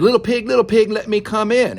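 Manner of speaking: high, loud coaxing wolf call. Acted. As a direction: call out to the little pig at the door. Wolf calls at pig's door.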